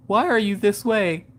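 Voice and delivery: son's weary question. Retold or imitated. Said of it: "And he's like".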